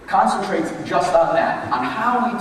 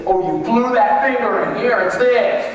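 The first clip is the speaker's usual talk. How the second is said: mock criticism; 5 semitones higher, 5 dB louder, slower than usual. Shown as a demonstration. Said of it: "Don't say".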